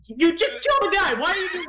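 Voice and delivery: high-pitched, disbelieving complaint; exasperated rant. Real, not acted. Ranting to friends about a dumb plot, high and exasperated.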